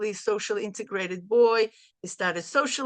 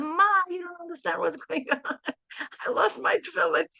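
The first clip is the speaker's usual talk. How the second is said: high, hurried panic with a nervous laugh; imitating a panicking son, higher; panicked son; 8 semitones higher than usual. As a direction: play the son losing it on the phone. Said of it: "he's in panic".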